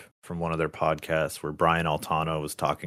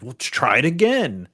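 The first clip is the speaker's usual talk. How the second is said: imitating a coach, firm and a bit louder; pushy advice; 5 semitones higher, 4 dB louder than usual. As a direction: nudge him to retry. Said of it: "my instincts would be like".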